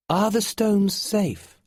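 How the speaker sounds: put-on a strange boy asking gently and slowly; mysterious boy. A narrator's reading. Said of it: "asked the boy".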